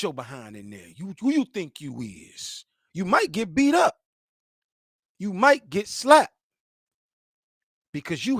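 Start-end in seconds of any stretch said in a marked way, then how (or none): none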